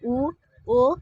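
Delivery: teacher demonstrating rounded vowels; vowel demo. Shown as a demonstration. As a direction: model ऊ, ओ with rounded lips. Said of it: "उदाहरण के लिए उ".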